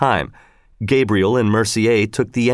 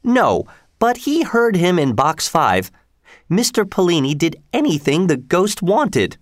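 higher woman's voice, earnestly confiding; Missus Giry; 6 semitones higher than usual. A narrator's reading. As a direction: play the believer explaining herself, pitched up.

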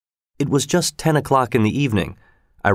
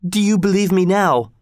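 high, insistent woman's question; crying out; 8 semitones higher than usual. A narrator's reading. Young woman challenging the guards who doubted her, crying out. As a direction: press the question in a raised pitch. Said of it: "she cried out".

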